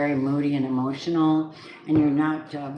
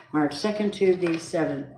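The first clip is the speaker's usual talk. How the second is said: ordinary talk, nothing marked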